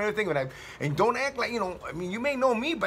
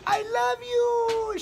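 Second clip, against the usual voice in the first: put-on long, high sing-out of an old lady; happy affection; much slower than usual. Older lady hugging him at church, happy affection. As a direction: imitate a delighted old lady, stretched high. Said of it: "She was happy to see me".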